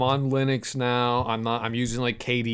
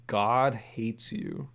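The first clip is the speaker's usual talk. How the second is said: normal talking voice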